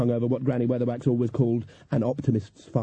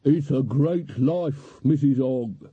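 slow, hollow undead drawl; zombie; 2 semitones higher, much slower than usual. A narrator's reading. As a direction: speak like a weary corpse. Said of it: "said the zombie".

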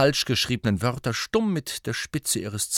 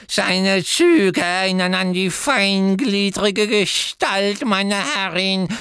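slow, higher, loud reverent croak; croaking elf; 11 semitones higher, 6 dB louder, slower than usual. A narrator's reading. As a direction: croak it like a devoted old servant. Kreacher then Dobby cut in.

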